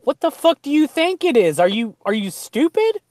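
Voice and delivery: high, loud mock outrage; mock exasperated retort. Scoffing at clueless city people, loud and exasperated. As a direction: snap back in exaggerated disbelief. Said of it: "It's like".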